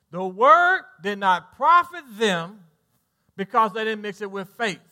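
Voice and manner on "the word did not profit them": slow and loud, profit rising; stress on profit. Shown as a demonstration. Preacher driving the point home to his church, slow and loud. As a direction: hammer out each word, profit rising. Repeats verse phrase for emphasis.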